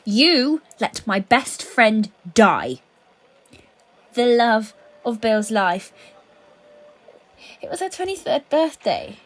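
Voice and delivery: loud, slow, each word driven home; slow loud seething blame; slow furious accusation. Acted. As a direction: lean into every word with fury.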